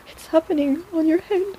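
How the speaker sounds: high, breathless wonder; gasp, then marvel; gasping amazement. Acted. Healer gasping in amazement at your hand. Gasps at the discovery.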